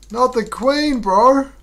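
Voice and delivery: high, pained yelp of dismay; dismayed cry. Real, not acted. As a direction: groan it out, horrified at the blunder. Dismay at blundering the queen.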